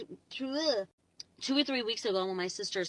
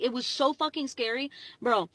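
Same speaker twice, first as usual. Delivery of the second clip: ordinary talk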